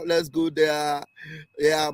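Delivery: normal talking voice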